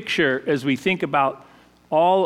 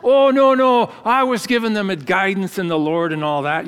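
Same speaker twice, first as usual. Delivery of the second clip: higher, lightly imitated Edinburgh brogue; Scottish brogue; 4 semitones higher, 4 dB louder than usual. Head dishwasher retells young Scottish teammate dodging dishes, imitated Edinburgh brogue. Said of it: "that thick, you know, Edinburgh brogue".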